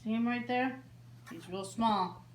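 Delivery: lilting singsong storybook read; reading to children. A narrator's reading. Librarian reading a bedtime book to children, gently lilting. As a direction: read it like story time for little ones. Reads picture book aloud.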